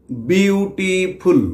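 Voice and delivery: Beautiful drawn out slowly as বিউ-টি-ফুল; syllable by syllable. Shown as a demonstration. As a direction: slowly give beautiful three separate beats. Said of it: "কেটে কেটে উচ্চারণ করি". (Beautiful, ধীরে কেটে কেটে তিন ভাগে)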